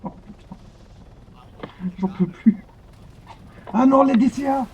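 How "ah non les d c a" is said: loud high cry of dismay at the anti-air; dismayed shout. Real, not acted. Pilot crying out at the anti-air, a loud dismayed shout. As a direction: shout it out, caught off guard.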